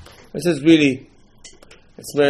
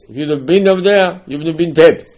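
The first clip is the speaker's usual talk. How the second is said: loud, raised-pitch acted rebuke; mock scolding; 5 semitones higher, 6 dB louder than usual. Father answers his questioning child, mock scolding. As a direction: imitate a father telling off his son.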